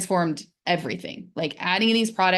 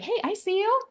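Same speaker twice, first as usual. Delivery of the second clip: bright, higher, welcoming hello; friendly greeting. Retold or imitated. Greeting her pain like a friend, high and welcoming.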